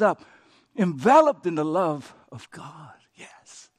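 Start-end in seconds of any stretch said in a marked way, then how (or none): 2.3-3.7 a reverent near-whisper; hushed whisper. Real, not acted. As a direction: breathe it out in a whisper. Pastor hushes on holy phrase.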